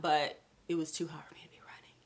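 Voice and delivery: breathy whispered aside; whispering. Real, not acted. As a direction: whisper it to yourself. Quiet aside about heat.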